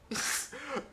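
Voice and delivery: short high chuckle; amused burst of laughter. Acted. Friend laughs in phone chat.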